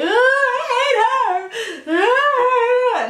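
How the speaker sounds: high wailing fake sob, stretched out slow; mock crying breakdown. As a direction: ham up a tearful breakdown. Mocks breakdown in bathroom.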